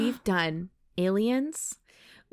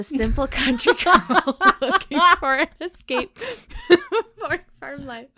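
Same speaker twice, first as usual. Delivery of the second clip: reading it again while laughing, louder; 6 semitones higher, 5 dB louder than usual. Shown as a demonstration. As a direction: try to read it but crack up. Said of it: "Use it in a sentence".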